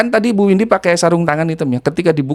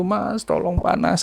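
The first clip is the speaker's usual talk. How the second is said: retold weeping plea; 3 semitones higher, 7 dB quieter, slower than usual. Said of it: "sambil nangis-nangis dia gitu".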